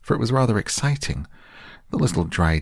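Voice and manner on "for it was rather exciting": 1 excited. A narrator's reading. Narration turns excited.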